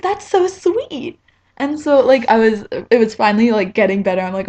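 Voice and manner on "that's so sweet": touched. Retold or imitated. AJ touched by the gift.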